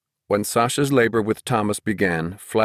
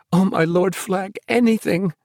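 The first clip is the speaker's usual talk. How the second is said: high-pitched fervent plea; desperate gasp; 11 semitones higher than usual. A narrator's reading. Mother pleading with Flag for the cure, fervently. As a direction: pledge yourself in a high, gasping voice. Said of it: "she gasped, and meant it".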